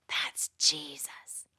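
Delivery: quick whispered aside; a hushed note to the kids. Acted. Aside inside verse.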